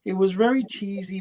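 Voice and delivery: ordinary delivery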